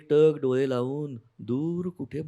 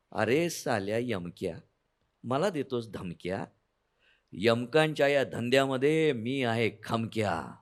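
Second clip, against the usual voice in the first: normal talking voice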